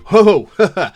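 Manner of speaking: loud, high mock recoil; exaggerated "whoa" of discomfort; startled "ho, ho". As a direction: exclaim as if backing away from bad news. Friend recoiling from honest answer.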